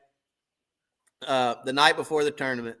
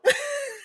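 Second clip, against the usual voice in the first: high laugh. Real, not acted. Laughing at own story.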